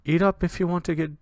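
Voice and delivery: a mother at the supper table; mother's voice. Acted. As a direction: urge your son to eat up. Game master voices the mother.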